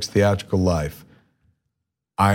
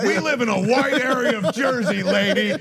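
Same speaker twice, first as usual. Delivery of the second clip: high, mock-gruff dad, much higher. Father answering the phone, mock-gruff and much higher. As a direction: play the blunt father on the phone. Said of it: "instantly it was like".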